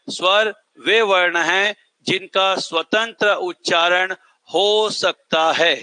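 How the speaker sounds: slow, word-by-word definition for students to copy; teacher dictating a definition to the class, slowly and deliberately; slow dictation. Shown as a demonstration. Teacher reading the definition aloud so students can write it, slow. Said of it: "तो पहला परिभाषा क्या हो गया?".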